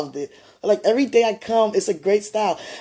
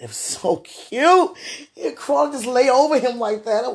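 normal talking voice

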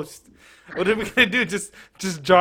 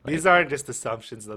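ordinary talk

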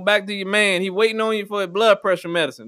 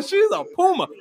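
higher words breaking into laughter; laughing; 9 semitones higher than usual. Real, not acted. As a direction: crack up mid-line.

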